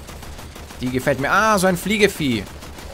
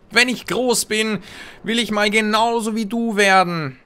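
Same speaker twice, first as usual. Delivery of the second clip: put-on childlike admiration; 7 semitones higher than usual. Admiring player mocks childlike awe.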